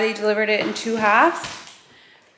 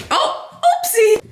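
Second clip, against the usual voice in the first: playful oopsie; 12 semitones higher, 4 dB louder, slower than usual. Real, not acted. Child tumbles, playful reaction.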